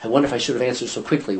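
quick, rushed worried aside; fast second-guessing. Retold or imitated. Peter second-guessing his answer, rushed and fast. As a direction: rattle it off like a nervous afterthought. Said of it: "He says".

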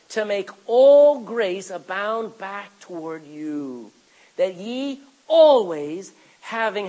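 drawn out, loud, every word weighted; slow scripture emphasis. Real, not acted. Preacher reading scripture to the congregation, slow and weighty. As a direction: read it slowly so it sinks in.

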